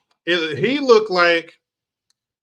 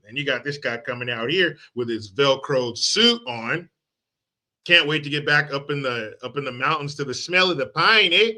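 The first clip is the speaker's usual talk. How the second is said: usual speaking voice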